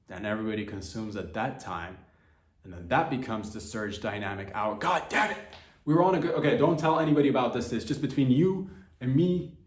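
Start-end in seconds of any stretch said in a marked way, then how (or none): 4.7-5.4 higher exasperated outburst; frustrated curse. Real, not acted. Realizes he spilled the secret. 5.8-9.6 louder, higher hushed-up urgency; urgent plea. Real, not acted. Begging listeners to keep secret.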